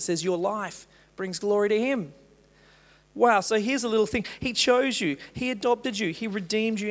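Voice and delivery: high, loud, driving preacher voice; full-force preaching. Acted. Pastor preaching full force to the congregation. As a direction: preach it out to the hall with fire.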